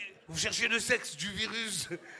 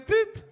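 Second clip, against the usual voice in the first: high beep; 11 semitones higher, 9 dB louder than usual. Retold or imitated. Thermometer beep imitated.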